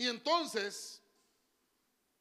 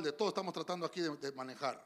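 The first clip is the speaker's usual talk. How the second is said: usual speaking voice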